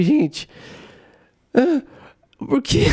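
high, bewildered little voice; mock shocked, pitched well up; retold dismay. Fan reacting to Cyborg's knockout, retold in high dismay.

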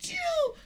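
playful "pew" laser shot; mock gunshot noise. Boy still playing the spy film, mock gunshot. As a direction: make a toy-gun pew sound.

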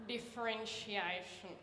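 slow, weary teacher drawl; lazy sighing teacher. Retold or imitated. Lazy maths teacher announcing topic, slow and weary. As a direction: drag the word out like a tired man.

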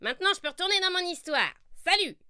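voix de sorcière aiguë et rapide; sorcière pressée. Acted. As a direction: file vite en disant salut. (sorcière pressée de partir)

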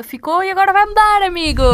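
loud and high, all hyped up; excited shout. Real, not acted. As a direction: announce it with a big excited burst. Excited announcement of new change.

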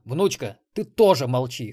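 loud, firm command; silencing order. A narrator's reading. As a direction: hush her loudly and firmly. Irritated programmer silences her. (громко и строго велеть молчать)

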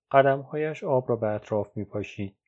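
plain reading voice

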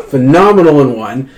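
ordinary talk, nothing marked

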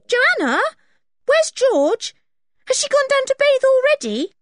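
high, loud call to the cook. Acted. Anne calling to Joanna about missing George, loud and high. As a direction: shout the question down the house.

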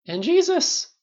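loud, very high exclamation; outraged cry. A narrator's reading. Rhodey ranting to friends over drinks, outraged cry. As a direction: burst out in indignation. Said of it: "Rhodey cried, waving his hands".

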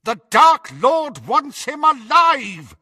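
high, loud, drawn-out yell; shouting. A narrator's reading. Malfoy stops Crabbe killing Harry, urgent shout. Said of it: "Malfoy shouted at Crabbe".

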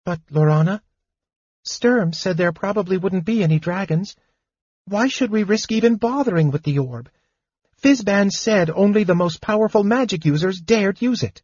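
a small kender bursting with news; chattering high kender, excited. Acted. Tas the kender's voice.

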